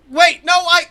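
high, very loud protest; urgent yell to stop someone leaving; shouting after someone. Acted. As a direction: shout it out in a panic. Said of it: "shouting after him".

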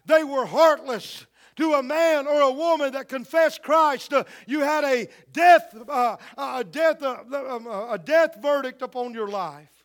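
loud, pounding preacher's cry on death; full-force preaching. Acted. Preacher thunders to the church about persecution, loud and rising. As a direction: hammer it home to the congregation.

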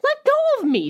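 over-the-top heroine yelling at her captor; mock furious demand. A narrator's reading. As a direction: shriek it at him like a melodrama belle. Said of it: "she demanded".

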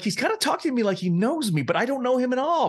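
high, quick puzzled thought; high fast inner voice. Retold or imitated. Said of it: "And I was like".

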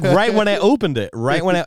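ordinary talk, nothing marked

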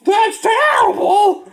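very high, slow, loud shocked shout. Acted. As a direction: shriek out the shock.

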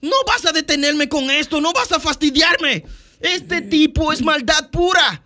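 very high, loud cartoon battle yell; Deadpool shouting. Shown as a demonstration. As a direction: bellow it like a manic antihero. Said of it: "si tú escuchas a Deadpool".

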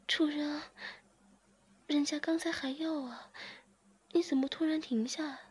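narrated character moaning and begging her master; moaning plea. A narrator's reading. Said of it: "志敏马上呻吟着说".

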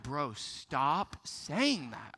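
low, slow, deadpan put-on voice; imagine the clerk's fed-up thought, slowly; a lightly voiced tired clerk, drawn out; mock annoyed clerk.

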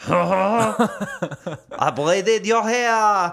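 hammed-up, high, drawn-out French accent. Co-host performing the hair-braiding line on request, in a mock French accent. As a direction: play up a mock French accent, slowly, through laughter. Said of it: "say this in a French accent".

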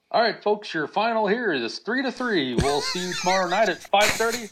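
high, imitated public-address sign-off; announcer. Announcer wrongly sending the fans home, imitated high announcer voice. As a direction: put on an announcer's voice for the crowd. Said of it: "he stands up and he says".

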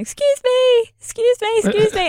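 very high, bright calling excuse me. Retold or imitated. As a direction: call out high to get his attention.

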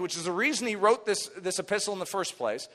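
usual speaking voice

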